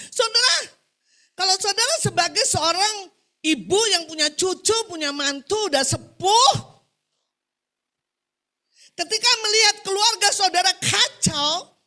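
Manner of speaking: loud, high, slowed declaration; preaching at full force. Real, not acted. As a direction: proclaim it loudly to the congregation.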